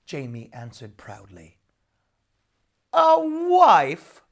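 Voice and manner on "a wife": high, loud roar of disbelief. A narrator's reading. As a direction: bellow it in outrage. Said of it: "roared the devil".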